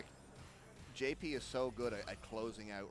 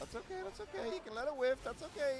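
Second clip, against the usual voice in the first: much higher, soothing mock reassurance; high-pitched reassurance; 13 semitones higher, 3 dB louder than usual. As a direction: coo it like calming a child.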